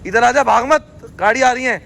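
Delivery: high-pitched call to come back; calling out warning. Real, not acted. Telling a child not to run as a car comes, calling out a warning.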